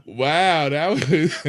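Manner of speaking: loud, high, drawn-out wows breaking into laughter; really bowled over, laughing in amazement; astonished. Real, not acted. Guest delighted by an unexpected question, astonished.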